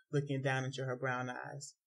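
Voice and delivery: ordinary delivery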